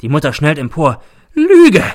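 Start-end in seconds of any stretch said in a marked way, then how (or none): 1.3-1.8 very high, very loud screech; shriek. A narrator's reading. Mother springing up at the teacher, shrieking. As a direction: shriek the accusation at full force. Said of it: "kreischt sie".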